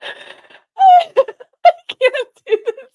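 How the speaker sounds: can barely talk for laughing. Real, not acted. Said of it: "I can't take this seriously".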